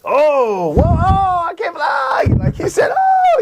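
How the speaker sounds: high, loud mock astonished cries; amazed audience gasps. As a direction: act out a crowd blown away. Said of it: "where I knew the audience would be like".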